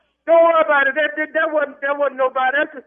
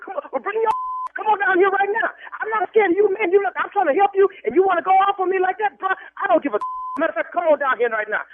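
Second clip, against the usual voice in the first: real heated, higher-pitched argument; angry challenge; 8 semitones higher than usual. Real, not acted. Man dares caller to come down here, angry. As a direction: stand up to him, worked up.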